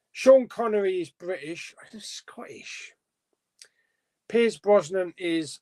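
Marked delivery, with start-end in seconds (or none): 1.8-2.9 whispered aside. Real, not acted. Muttered correction while reading list.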